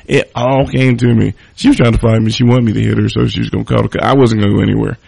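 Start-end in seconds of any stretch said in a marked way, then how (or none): none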